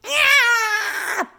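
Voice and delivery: high loud scream of fright; startled yell. Acted. Father shrieking loudly when scared by Lance. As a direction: shriek and fall over. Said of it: "His father yelled".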